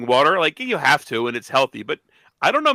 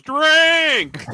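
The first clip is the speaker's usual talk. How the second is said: sudden loud, high-pitched bellow; imitated app yell; 14 semitones higher, 6 dB louder than usual. Co-host mimics the bottle's app yelling at its owner, loud imitated yell. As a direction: yell it out like the app barking an order. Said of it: "It just yells".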